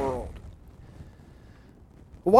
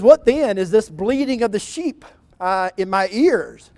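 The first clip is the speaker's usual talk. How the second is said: louder, rising accusing questions; indignant rebuke; 3 semitones higher, 6 dB louder than usual. Acted. Samuel confronts Saul about the spared sheep, loud indignant rebuke. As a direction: confront him, getting louder.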